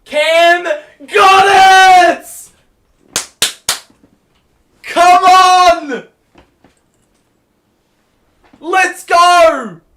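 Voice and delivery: high, loud, roaring celebration; real full-throated cheering; ecstatic shout. Real, not acted. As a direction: scream it for the big bucket. Clutch basket celebration.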